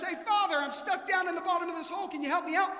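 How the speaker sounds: calling up. Acted. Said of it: "And he says".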